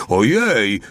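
exclaimed wonder with a falling end; calling out. A narrator's reading. Little one watching woman fall with vase, calling out. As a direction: cry out a startled oh dear. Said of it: "wołał mały".

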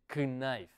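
KNIFE said wrongly with a voiced k and extra vowel, "kuh-nife"; wrong pronunciation. Shown as a demonstration. Teacher showing learners what not to say, mispronounced KNIFE as "kih-nife". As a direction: sound out the silent k in KNIFE. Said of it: "No se dice knife".

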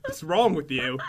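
ordinary talk, nothing marked

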